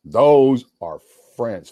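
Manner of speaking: "Those are friends" drawn out at half speed; slow emphasis. Real, not acted. Man praising lasting friendships, "Those are friends" slow and emphatic. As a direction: spell out "Those are friends" slowly and weightily.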